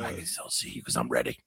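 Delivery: low, whispery mock menace; hushed action-star warning; Steven Seagal. Host squinting as Seagal, sinister whisper. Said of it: "like a sinister whisper".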